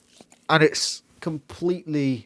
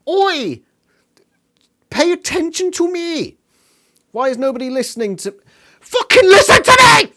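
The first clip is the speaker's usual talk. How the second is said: much higher, building to a cracked yelling shout; mock frantic shout; 13 semitones higher, 10 dB louder than usual. Imitating Cassie yelling at a panicking crowd, rising shout. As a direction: yell for attention, getting desperate. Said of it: "And Cassie is like".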